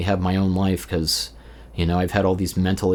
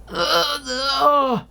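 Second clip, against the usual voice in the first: very high squeaky gremlin grunts; slower than usual. Retold or imitated. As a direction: gremlin voice, pitched way up. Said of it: "gremlin voice".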